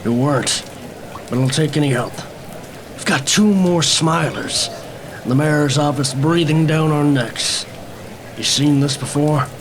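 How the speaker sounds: lightly voiced weary cop; gruff police captain. Captain talking to the detective over the bodies, a lightly voiced weary cop. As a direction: play the tired captain at the scene.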